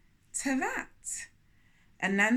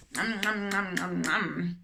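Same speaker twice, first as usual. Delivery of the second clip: greedy eating noises; nom nom munching; 3 semitones lower than usual. A narrator's reading. Anansi dreams of lunch, munching greedily. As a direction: chomp happily on imaginary food. Munching sounds over food.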